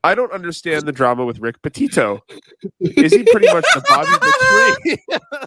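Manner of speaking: reading the tweet aloud, laughing; mocking reading of tweet. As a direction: read it out with rising laughter. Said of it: "He said".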